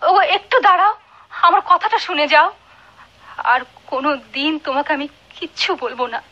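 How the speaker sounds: wife begging her husband to hear her; pleading. Acted. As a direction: beg him to stay and listen. Wife begs departing husband.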